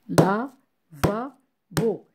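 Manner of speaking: lavabo slowly split into la, va, bo; syllable by syllable. Shown as a demonstration. Teacher counting syllables with claps, lavabo slow and split. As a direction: break lavabo into three slow, separate beats. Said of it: "compter les syllabes en frappant des".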